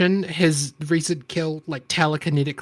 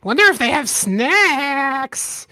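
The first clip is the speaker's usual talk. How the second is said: high, halting goblin speech; broken-English little goblin; 9 semitones higher, 3 dB louder, slower than usual. Acted. Goblin wondering about food.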